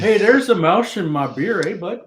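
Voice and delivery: hammed-up Canadian accent. Joking to co-hosts about saying "eh", mock Canadian accent. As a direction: play a cartoon Canadian with a tagged-on "eh". Hamming up Canadian talk after "eh.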